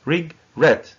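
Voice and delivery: regret split as re... GRET; syllable-by-syllable re-GRET with a strong ending; stress on second syllable. Shown as a demonstration. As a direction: pause inside regret, then hit GRET. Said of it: "the stress is on the second syllable".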